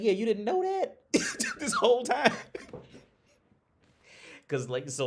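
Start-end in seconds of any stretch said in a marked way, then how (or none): 0.0-2.4 high squealing laugh through words; laughing hard. Real, not acted. Teasing girlfriend who never got the joke, laughing. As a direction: crack up while teasing her.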